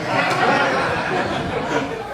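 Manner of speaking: breathy amused laugh. Real, not acted. After his anecdote.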